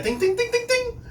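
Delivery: high ringing "ding ding ding"; repeated high-pitched dings; imitated bell. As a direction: chime it fast like a bell. Imitated bell celebrating a friend.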